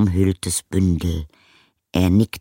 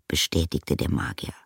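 plain reading voice

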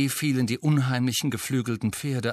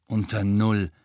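glum; slower than usual. A narrator's reading. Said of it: "sagte Harry verdrossen".

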